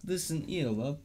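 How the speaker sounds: put-on jokey British accent; comic Brit addressing a lover. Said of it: "I can do the accent".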